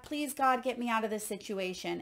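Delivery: ordinary delivery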